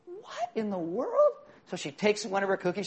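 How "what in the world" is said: shocked disbelief. Retold or imitated. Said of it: "She's like".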